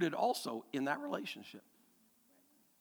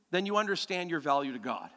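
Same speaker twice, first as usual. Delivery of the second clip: ordinary talk, nothing marked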